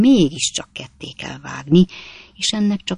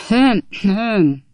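pointed, raised "hem, hem"; loud throat-clearing hem; 5 semitones higher, 4 dB louder than usual. A narrator's reading. Man behind curtain signals the lady, loud throat-clearing. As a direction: clear the throat loudly to be noticed. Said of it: "hangosan köhintett egyet".

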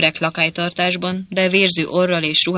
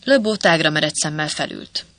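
usual speaking voice

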